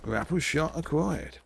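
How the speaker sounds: slow announcer voice echoing the game; mimicking announcer. Echoing the game's callout to his stream, slow announcer voice. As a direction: repeat it like the game's narrator, slowly.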